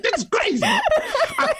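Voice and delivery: high, giggly amazed reaction; laughing disbelief. Retold or imitated. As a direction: squeal it through laughter. Retelling own reaction, laughing.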